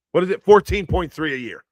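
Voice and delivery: usual speaking voice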